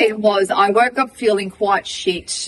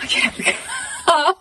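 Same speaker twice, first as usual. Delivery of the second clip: 1 frantic laughing; slower than usual. Real, not acted. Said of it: "And I'm frantic".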